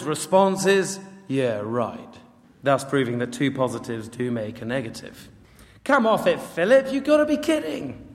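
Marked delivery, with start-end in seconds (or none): 1.3-2.2 low, dry sarcastic brush-off; dismissive scepticism. Acted. Nathanael answering Philip's claim, dismissive. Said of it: "translate into more accessible terms". 5.8-8.0 high-pitched disbelieving protest; incredulous scoffing. Acted. Sceptical Nathanael dismissing his friend Philip, incredulous.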